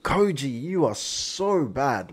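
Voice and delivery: drawn out, teasing disbelief; slow, playful tsk at a friend; slow mock scolding. Real, not acted. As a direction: stretch it like a fake scold. Reacting to Koji's coldness.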